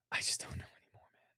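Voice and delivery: weary whispered aside. Real, not acted. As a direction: breathe it out, barely voiced. Exasperated aside.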